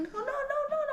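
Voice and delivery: high, rapid-fire refusals; soft, very high mock panic; child freaking out. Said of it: "ela já surtava".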